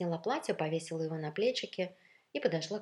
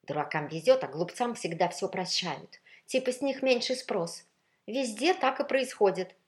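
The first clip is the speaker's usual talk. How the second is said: raised, resentful pronouncement; envious, spiteful; 5 semitones higher, 7 dB louder than usual. A narrator's reading. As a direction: join the gossip with bitter envy. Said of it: "завистливо подхватил злобный ледяной тон".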